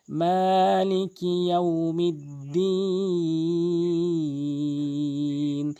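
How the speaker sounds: very slow, chanted মালিকি ইয়াউমিদ্দিন with long মা; stretched recitation. Shown as a demonstration. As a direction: hold মা and দিন in মালিকি ইয়াউমিদ্দিন. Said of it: "মিম এক আলিফ টানবেন".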